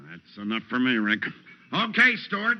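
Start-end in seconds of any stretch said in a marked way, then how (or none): 1.7-2.6 calling out. Acted. Walt calls out to the suspect.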